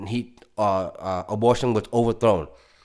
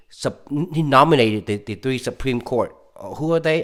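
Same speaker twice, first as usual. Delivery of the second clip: ordinary talk, nothing marked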